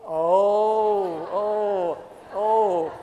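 loud drawn-out oh, oh, oh of mock dismay; mock dismayed groans. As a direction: groan loudly as if the bet was lost. Losing bet, mock dismay.

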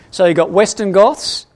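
ordinary talk, nothing marked

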